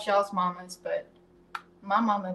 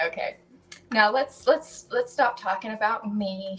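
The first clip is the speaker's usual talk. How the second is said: usual speaking voice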